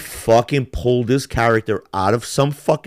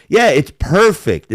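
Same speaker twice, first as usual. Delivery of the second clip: normal talking voice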